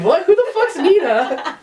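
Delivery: very high, drawn-out incredulous question; mock bafflement. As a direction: imitate a totally confused friend. Baffled friend's reply acted out.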